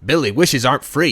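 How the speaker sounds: loud, indignant scolding; angry leprechaun. Acted. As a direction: scold him at full voice. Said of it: "the leprechaun gets angry".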